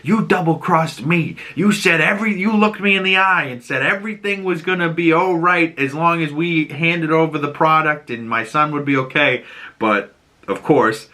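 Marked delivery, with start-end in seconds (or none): 0.0-9.4 higher, put-on accusing voice, lightly angry; mock outrage at a betrayal; a touch of furious mobster, hammed up; pissed-off Sal. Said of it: "And Sal's pissed".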